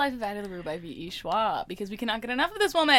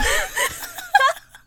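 very high squealing laugh; high laugh-cry squeal; shrieking laugh; 5 dB louder than usual. Real, not acted. Laughing fit.

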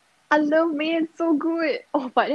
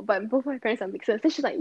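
ordinary talk

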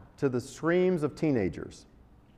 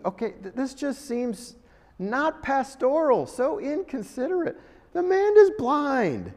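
much higher, indignant disbelief; incredulous; 9 semitones higher than usual. Real, not acted. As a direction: protest the rudeness in disbelief. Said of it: "mock outrage at Jesus".

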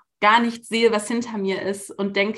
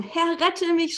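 read-out cry for help; 7 semitones higher, slower than usual. A narrator's reading. Petrus sinking, calling to Jesus in a mild raised cry. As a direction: lift the pitch on a half-voiced plea. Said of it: "schrie er".

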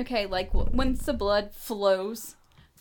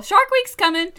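loud, raised-pitch mock quote; mock announcing; 9 semitones higher, 9 dB louder than usual. Woman quoting clueless men, loud mocking. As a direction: call it out like a clueless man. Acting out clueless men's remark.

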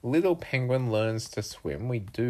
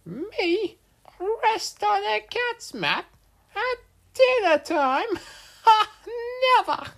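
very high, loud squeaky bird; outraged little cartoon bird; indignant bird; 7 dB louder than usual. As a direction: squeal it in scornful disbelief. Indignant bird refuses the cat.

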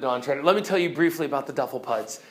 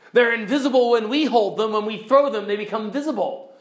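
high, emphatic creature voice; raised, boastful-sounding storybook voice; invisible creatures; 7 semitones higher, 5 dB louder than usual. Acted. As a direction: explain loudly as an unseen creature.

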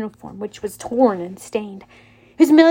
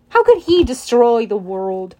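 ordinary delivery

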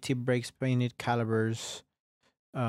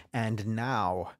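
normal talking voice

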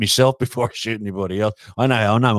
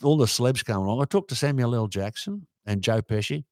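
normal talking voice

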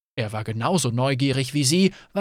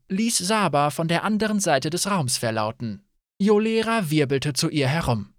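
ordinary delivery, nothing marked

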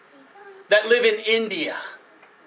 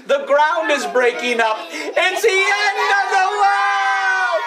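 teacher leading the class in a panicked chant; mock panic; 7 semitones higher than usual. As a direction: cry out like the sky is falling. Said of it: "say it like you mean it".

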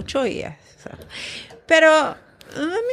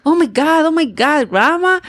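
excited grandson; 8 semitones higher than usual. Retold or imitated.

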